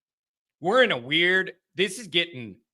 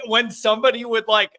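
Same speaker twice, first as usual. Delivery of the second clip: normal talking voice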